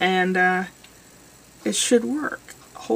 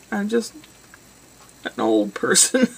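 put-on old person voice. Self-mocking old voice.

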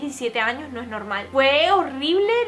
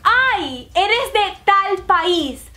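slow, loud, higher imitation of a stranger; imitated stranger; 5 semitones higher, 5 dB louder, much slower than usual. Man behind her asks about her country, imitated stranger. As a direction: draw it out as the man calling from behind. Retells the stranger's sudden remark.

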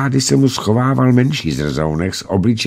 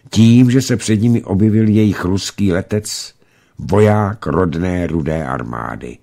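usual speaking voice

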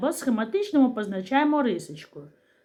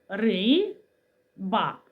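Ри-ба in two separated parts; split into syllables; 2 semitones lower than usual. Shown as a demonstration. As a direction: pause between Ри and ба. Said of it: "складається із двох частинок".

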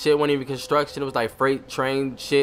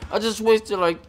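ordinary talk, nothing marked

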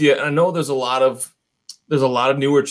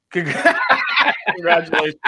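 laughing; 3 semitones higher, much slower than usual. Real, not acted. Laughing through giveaway announcement.

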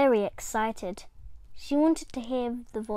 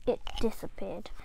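ordinary delivery

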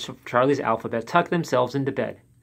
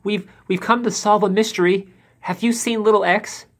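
nervous; 8 semitones higher, 4 dB louder than usual. A narrator's reading. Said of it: "Brave little B spoke up".

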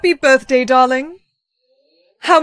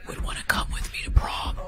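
whispering; 13 dB quieter than usual. Acted. Mocking prom invitation.

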